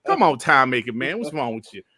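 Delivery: ordinary talk, nothing marked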